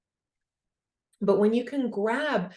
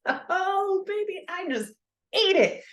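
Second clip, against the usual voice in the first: much higher, gleeful whoop at a wipeout; thrilled crash; 12 semitones higher than usual. Retold or imitated. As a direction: laugh off the fall with delight.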